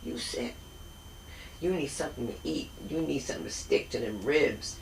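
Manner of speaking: soft, whispered auntie fussing; fussing Southern auntie; relatives' voice. Retold or imitated. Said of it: "they're like".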